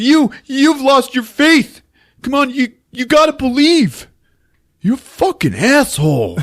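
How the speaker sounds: high, impassioned comeback, hammed up; mock indignation. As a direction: argue back high and desperate, overacting. [name] fires back in acted quarrel.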